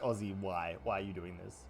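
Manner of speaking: low, soft mock dismay; deadpan imagined complaint; imitated baffled listeners. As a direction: sink into a quiet, weary plea. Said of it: "you're gonna be like".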